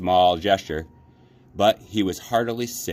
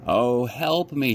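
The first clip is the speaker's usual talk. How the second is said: slow, higher plea for help; pleading; 6 semitones higher, 3 dB louder, slower than usual. A narrator's reading. Sinking rabbit pleading to be saved, slow and pleading. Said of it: "he thought".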